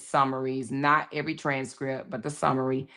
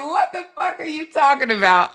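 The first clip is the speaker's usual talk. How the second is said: mock indignant outburst from Chat G P T; 9 semitones higher, 8 dB louder than usual. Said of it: "Chat G P T gonna be like".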